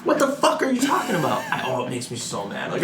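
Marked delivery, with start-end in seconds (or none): 0.0-1.4 exasperated outburst. Real, not acted. Exasperated rant.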